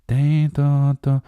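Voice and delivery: sing-song imitation of a bell ringing; ding dong ringing. Actor chiming a bell tune down the phone.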